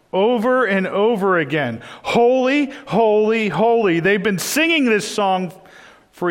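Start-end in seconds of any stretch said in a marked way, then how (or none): 2.0-4.0 angels' cry. Acted. Preacher voicing angels' endless song.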